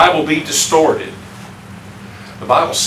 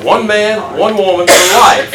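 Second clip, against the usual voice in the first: firm declaration; 6 semitones higher, 7 dB louder, slower than usual. Shown as a demonstration. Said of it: "That's not gonna fly today".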